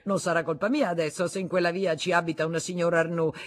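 defensive. Acted. Husband protesting to his wife, defensive. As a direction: hurry out the excuse.

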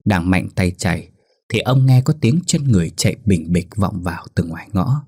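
ordinary delivery, nothing marked

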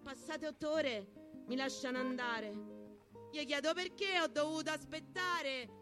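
ordinary talk, nothing marked

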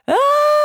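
very high, sustained shriek; high wail of alarm; piercing scream. A narrator's reading. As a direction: scream on one high note. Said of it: "полетел по дому пронзительный вопль".

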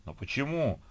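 high, startled protest; shocked exclamation. Acted. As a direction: ask in disbelief. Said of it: "в голос воскликнули".

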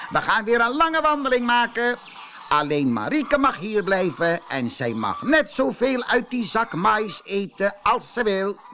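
high, loud, gloating rooster; bossy rooster. Acted. Rooster orders a long walk, gloating and loud. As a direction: gloat while giving orders.

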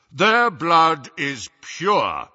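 slow deep booming voice; deep commanding. A narrator's reading. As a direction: proclaim it with weight. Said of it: "his deep voice echoed impressively".

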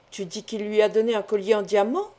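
plain reading voice

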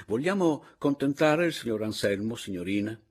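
loud, emphatic public address; pointed proposal. A narrator's reading. Narrator proposes questioning Max to the caporale and Papiano, pointed. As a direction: propose it firmly to the room.

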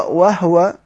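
وهو with the هـ left vowelless, "wah-wa"; sukun reading. Shown as a demonstration. Teacher shows students Qalun's reading, وهو with silent-vowel h. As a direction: close the first syllable of وهو on an h. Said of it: "قلنا قالون بيقرأ".

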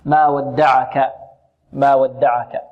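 ما ودعك slow with every letter thin; light thin letters, slow. Shown as a demonstration. Teacher modelling ما ودعك for the class. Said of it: "كلها مرققة".

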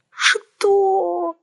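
high anguished wail. A narrator's reading. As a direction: cry it out in dismay. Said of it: "возопила я ещё горестней".

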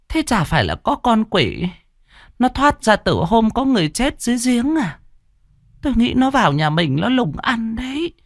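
put-on a sighing old woman sure it's a demon; grandmother's grim explanation. A narrator's reading. Grandmother explains the demon from the well, grim. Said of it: "Bà nội thở dài".

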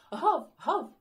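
loud high woofs; dog barking. Acted. Dog chiming in, loud barking. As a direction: bark eagerly. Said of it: "озвався Бровко".

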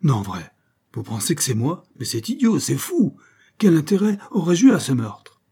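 a man stunned at being accused; astonished protest. Acted. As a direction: protest in shocked amazement. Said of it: "il demanda avec étonnement".

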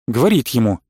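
usual speaking voice

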